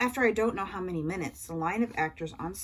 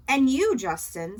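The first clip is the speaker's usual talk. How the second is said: hearty; 7 semitones higher, 8 dB louder, slower than usual. A narrator's reading. Dad greeting Justin.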